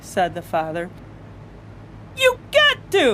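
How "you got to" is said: high, loud insisting cashier; sharp retort in a silly quarrel. Acted. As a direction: push back hard.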